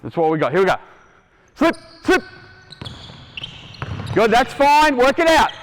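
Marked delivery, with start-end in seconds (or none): 1.5-5.5 high shouted calls across the court; calling out loud. Real, not acted. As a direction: call it out loud and high to the players.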